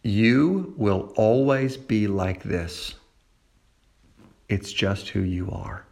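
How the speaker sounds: slow, low, quiet accusing voice; the inner lie, low and slow. Acted. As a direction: murmur it like a whisper in the heart. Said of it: "It goes something like this".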